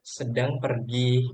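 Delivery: slow, careful reading with no closing tune; slow example read. Shown as a demonstration. Teacher reads example clause slowly to students. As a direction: drag the example out so students hear it.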